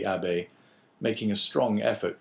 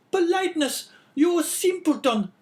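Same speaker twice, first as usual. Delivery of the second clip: much higher, louder, harsh woman's voice; sickly scolding wife; 6 dB louder than usual. A narrator's reading. Wife calling down the stairs at husband, scolding. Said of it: "retorted La Carconte".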